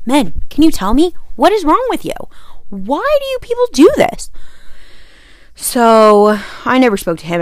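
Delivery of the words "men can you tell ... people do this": climbing much higher with each question; rising incredulous rant; exasperated plea. Real, not acted. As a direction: plead with mock despair. Ranting at men in general.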